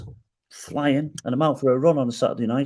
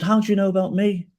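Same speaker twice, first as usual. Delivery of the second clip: higher-pitched retold bafflement; baffled; 7 semitones higher than usual. Retelling his question to the hero who phoned him, baffled. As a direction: ask in astonished puzzlement.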